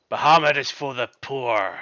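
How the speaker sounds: loud, forceful dragonborn war cry; yelling. Acted. Dragonborn chasing someone through the village, yelling. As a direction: yell it out at a fleeing victim. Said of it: "what you hear yelling behind you".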